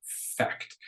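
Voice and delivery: low, unvoiced one-word catchphrase; Dwight Schrute. Teacher quoting a sitcom character to the class, Dwight Schrute impression. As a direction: drop it softly, almost voiceless, as a quoted verdict. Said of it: "as my friend Dwight Schrute would say".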